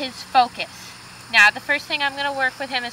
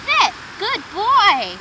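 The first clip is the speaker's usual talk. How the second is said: much higher, bright "Sit" then praise; high command and praise; 6 semitones higher, much slower than usual. Real, not acted. Owner cueing her dog then praising, high. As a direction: cheer the dog on.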